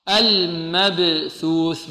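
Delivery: المبثوث very slow, the ب bounced; qalqalah on baa. Shown as a demonstration. Teacher recites slowly to show qalqalah. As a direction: stretch المبثوث and pop the ب. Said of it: "الباء ... القلقلة".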